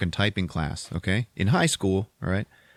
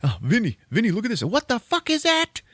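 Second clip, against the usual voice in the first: climbing higher and higher in awe; amazed teen; 12 semitones higher than usual. Retold or imitated. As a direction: go from wonder to freaked out. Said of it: "and he's like".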